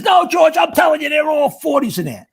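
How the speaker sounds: insistent protest. Retold or imitated. Jim insisting to George the game is rigged, exasperated. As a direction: pitch it way up in mock exasperation.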